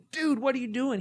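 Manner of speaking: loud startled call of dude, what are you doing; exasperated yell. Retold or imitated. Dad finding his son in the sink, yelling in alarm.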